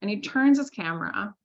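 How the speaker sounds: usual speaking voice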